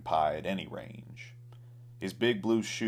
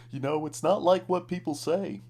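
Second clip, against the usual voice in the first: high, louder clown voice pleading; sputtering clown; 11 semitones higher, 4 dB louder than usual. A narrator's reading. Clown pleads with cowboy, high sputtering voice. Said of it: "McGee sputtered at the man".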